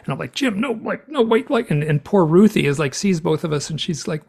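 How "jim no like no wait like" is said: protesting. Retold or imitated. Pleading with brother who doubts.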